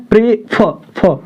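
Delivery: Pray then फ, the फ split off and repeated; pray, a beat, then a punched फ; stressed final syllable. Shown as a demonstration. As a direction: break the word apart and press on फ. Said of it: "दबाव दे करके बोल रहे हैं".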